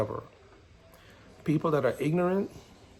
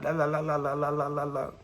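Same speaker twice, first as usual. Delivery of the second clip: mock chatter; 2 semitones higher than usual. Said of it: "you're talking a lot".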